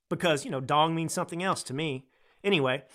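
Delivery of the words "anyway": usual speaking voice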